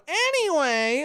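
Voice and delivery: high, drawn-out "anyway"; mocking self-impression. Host mocking his habit in conversation, exaggerated self-impression. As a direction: exaggerate your own verbal tic in a high voice. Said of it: "I keep going".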